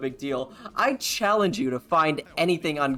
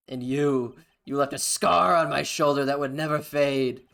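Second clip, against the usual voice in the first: villain's threat; 2 semitones higher, 6 dB louder than usual. Acted. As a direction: threaten him like a vengeful prosecutor. Voicing Von Karma's threat.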